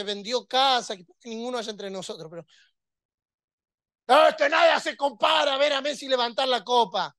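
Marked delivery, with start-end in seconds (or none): 4.1-7.1 high, loud shouting fan voice; excited mock fan. Preacher voicing a fan shouting about Messi lifting the cup. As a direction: yell it like a thrilled supporter.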